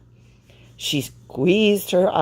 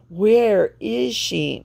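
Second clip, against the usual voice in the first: wondering aloud; 5 semitones higher, much slower than usual. A narrator's reading. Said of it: "May May wondered".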